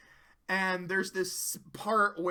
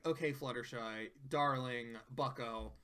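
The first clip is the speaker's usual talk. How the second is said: much lower, softer coaxing voice; 7 semitones lower, 9 dB quieter than usual. Retold or imitated. Friends calming Fluttershy, low soft coaxing. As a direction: ease her down gently. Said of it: "Rarity and Pinkie are like".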